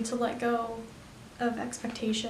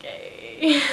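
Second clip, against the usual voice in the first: excited; 5 semitones higher, 5 dB louder than usual. Retold or imitated. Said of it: "and I was like".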